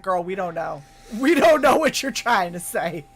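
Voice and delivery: sassy autocorrect. Retold or imitated. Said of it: "autocorrect's like".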